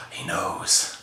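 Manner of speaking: whispered, conspiratorial; paranoid whisper. Retold or imitated. Retelling his wife's suspicion, whispered and paranoid. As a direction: hush it like the neighbors might hear.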